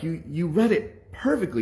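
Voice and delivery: high-pitched imitated disbelief; shocked. Teacher amazed by the boy's perfect reading, shocked.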